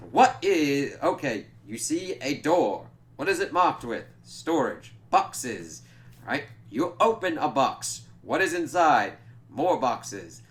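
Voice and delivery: emphatic imitated hypnotist, words punched loud; Laszlo, commanding hypnotist. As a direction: lead the subject with odd, punched emphasis. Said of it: "doing the commanding hypnotist voice".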